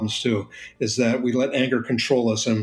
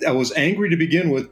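normal talking voice

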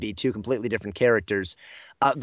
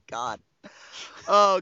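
ordinary talk

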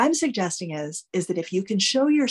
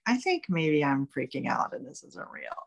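calm logical voice; 5 semitones lower, 6 dB quieter than usual. Retold or imitated. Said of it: "One is kind of logical".

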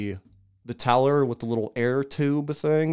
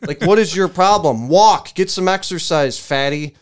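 higher and louder mock yelling; imagined outburst, higher and much louder; pretend scream; 5 semitones higher, 8 dB louder than usual. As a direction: yell it out the car window at him. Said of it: "heard me screaming".

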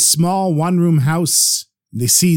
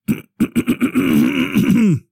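throat clearing; 5 semitones higher than usual. A narrator's reading. Dying old man gathers strength, clearing his throat. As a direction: clear the throat before speaking at last. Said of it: "But then he cleared his throat".

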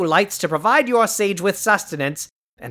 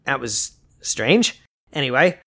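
low, puzzled aside; 7 semitones lower than usual. Acted. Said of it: "Skeletor aside after the outburst".

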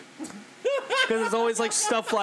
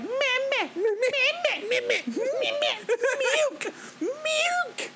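put-on high whiny baby bleating; mocking blah blah; much slower than usual. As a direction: whine like a grown man playing baby. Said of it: "He's just like".

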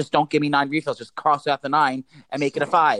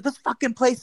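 higher, exasperated cursing; mock yell without the volume; retold screaming; 8 semitones higher than usual. Customer going off in the pharmacy, mild retold screaming. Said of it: "I'm going off screaming".

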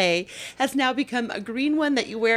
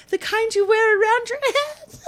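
daughter's added detail; 5 dB louder than usual. Retold or imitated. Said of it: "she goes".

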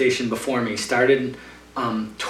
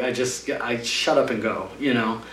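ordinary delivery

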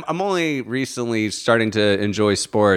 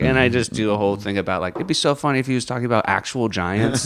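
usual speaking voice

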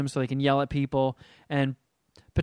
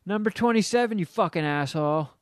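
mock yell; 3 semitones higher than usual. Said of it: "I hear this guy".

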